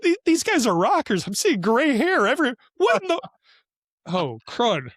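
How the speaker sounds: high, loud comic disbelief, retold; exaggerated incredulous outburst, pitch swooping high; mock dismay. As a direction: act out a dawning, horrified realisation for laughs. Retold shocked realisation at concert.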